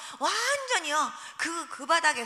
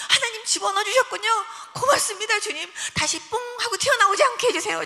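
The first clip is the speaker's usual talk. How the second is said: higher, louder grateful prayer bursting out; excited thankful prayer; 7 semitones higher, 7 dB louder than usual. Retold or imitated. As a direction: pray with joyful relief, raised voice. Joyful prayer after navel went in. (들뜬 감사 기도)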